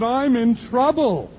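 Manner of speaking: hammed-up worried woman; woman in trouble. The woman telling the preacher her trouble, high and slow.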